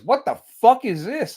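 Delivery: mock outraged disbelief; retold indignant shock. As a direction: act out being barged in on. Retold startled reaction to intruders.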